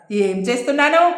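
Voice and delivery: ordinary delivery, nothing marked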